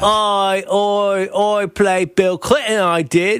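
high, drawn-out hammed-up British; deliberately bad British accent. Host goads co-host with deliberately awful British accent. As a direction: mangle a British accent on purpose, slow and high. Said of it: "you're trying to do it bad".

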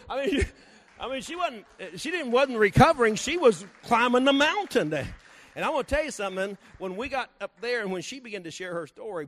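loud, high revival preaching; booming testimony preaching; preaching at full force. Acted. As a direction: lift the church with the testimony.

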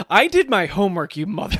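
high, loud laughing banter; gleeful playful boasting; excited banter. Real, not acted. Playful boasting about homework.

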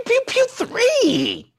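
high, slow zapping pew pew; laser pew sound. Acted. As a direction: play it like a toy laser gun. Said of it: "rapid fire number pew".

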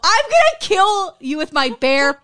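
loud, emphatic mock outrage; mock threat. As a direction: threaten a friend in jealous jest, slowing at the end. Mock-threatens friend out of envy.

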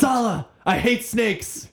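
high, slow, hammed-up British Indiana Jones line; mock British accent. To Sala, complaining about snakes in a mock British accent. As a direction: drawl it out in a put-on British voice. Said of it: "yell at them in a British accent".

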